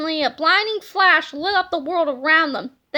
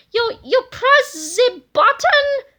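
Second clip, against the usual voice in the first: high, loud, slow disbelieving cry; stunned, slow scream of disbelief; incredulous scream; 8 semitones higher, 6 dB louder, slower than usual. A narrator's reading. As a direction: shriek it in shock. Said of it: "screamed Super Mega Tippy incredulously".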